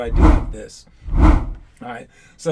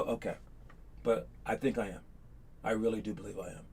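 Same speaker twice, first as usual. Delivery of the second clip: much softer, calm and modest; calm, not cocky; 7 semitones lower, 14 dB quieter than usual. Retold or imitated. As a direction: say it quietly, without arrogance. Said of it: "You don't say, say it in a cocky way or any kind of mean way".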